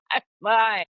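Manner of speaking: high, loud mock insistence; put-on fine. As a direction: insist too hard that all is well. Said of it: "the outside [name], which was fine".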